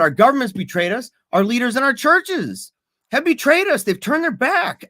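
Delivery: impassioned outburst, pitched high and climbing; half-reenacting a tearful outburst, rising high; retold lashing out. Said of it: "I lashed out and I said".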